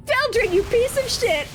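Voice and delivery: furious squeal. Acted. Party member cursing Feldrin, furious squeal. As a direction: curse him out.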